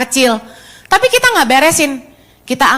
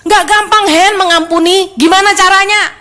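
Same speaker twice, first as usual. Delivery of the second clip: counselee complaining; 5 semitones higher than usual. Retold or imitated. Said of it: "orang bilang gini".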